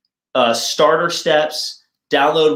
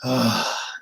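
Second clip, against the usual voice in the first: sigh demo; 3 semitones lower, 6 dB quieter than usual. Shown as a demonstration. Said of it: "the exhale of a sigh".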